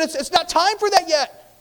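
high, loud stammered protest; terrified demons. Acted. Demons protesting to Christ that it is too early, terrified shout. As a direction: plead at a high shout, acting terror. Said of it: "the demons are terrified".

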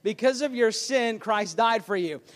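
normal talking voice

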